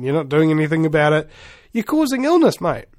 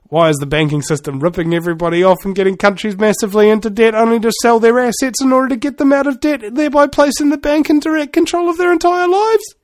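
fast question climbing steadily higher and higher; building rant; 4 semitones higher, 3 dB louder than usual. Acted. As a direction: wind it up from normal pitch to an exasperated high peak. Comic rant builds to punchline.

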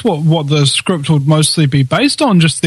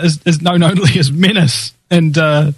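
normal talking voice

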